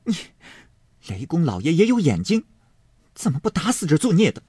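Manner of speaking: mildly acted bitter curse; cold sneer. A narrator's reading. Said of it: "冷笑道". (冷笑着咒骂)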